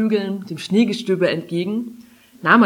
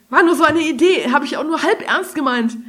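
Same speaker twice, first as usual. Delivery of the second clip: higher-pitched defensive excuse; hasty backpedalling; 8 semitones higher, 4 dB louder than usual. A narrator's reading. Kari excuses her idea to the dragon, defensive. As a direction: play it down quickly, caught out.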